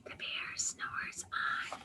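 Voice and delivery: hushed whisper so the bear stays asleep. A narrator's reading. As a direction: breathe the words without voice. Bear asleep, reading softly.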